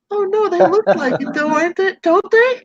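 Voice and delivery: put-on high, proud old-lady voice; sweet old lady. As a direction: show off the plants innocently.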